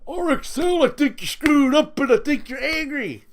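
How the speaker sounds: high, sneering imitation of a critic; mock-whiny parody of the critic; mocking whiny quote. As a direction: parrot him back in a silly high voice. Mocking Nate's words back at him.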